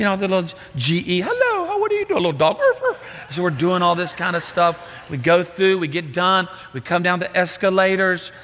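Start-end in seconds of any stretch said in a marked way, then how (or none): 1.2-2.6 high squealy delight at the sights; giddy sightseeing. Retold or imitated. Granddad riding the monorail, squealing with glee. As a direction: gush like a thrilled kid on a ride.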